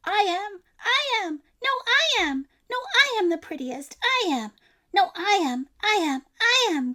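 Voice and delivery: each cat clamoring to be chosen; cats crying out. A narrator's reading. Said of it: "cried hundreds and thousands".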